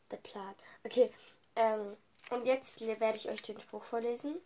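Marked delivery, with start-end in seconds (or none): 0.1-0.5 soft breathy whisper. Real, not acted. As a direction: whisper it to yourself. Repeats phrase in a whisper.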